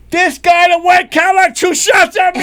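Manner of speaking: high, loud shouting of the sanitation man; mock shouted complaint. Sanitation man yelling to cops upstairs, high and loud. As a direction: yell it like an outraged witness. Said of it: "I hear they go".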